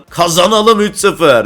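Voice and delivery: high, loud, drawn-out cheer; slow, elated shout of victory; triumphant. Real, not acted. Gamer cheering a simulated derby win, triumphant.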